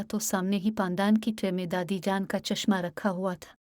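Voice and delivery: ordinary delivery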